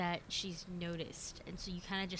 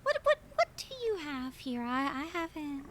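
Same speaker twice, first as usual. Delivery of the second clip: much higher, fluttery prim lady voice; genteel lady; 9 semitones higher, 9 dB louder than usual. Acted. Edith asking the diner what drinks they have, prim and high. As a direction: flutter politely, a bit overwhelmed. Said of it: "Edith continues and goes".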